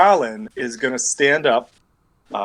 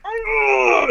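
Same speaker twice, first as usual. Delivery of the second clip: sharp, high grunt of rage; mock furious grunt; angry grunt. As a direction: grunt as he clenches his muscles. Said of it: "he gets angry and is like".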